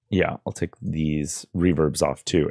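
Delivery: normal talking voice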